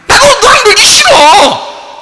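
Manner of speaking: put-on very high, loud, slow childish cry; high mock-childish shout; shouted refusal. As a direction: squeal a refusal to play. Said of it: "이게 아포티나스".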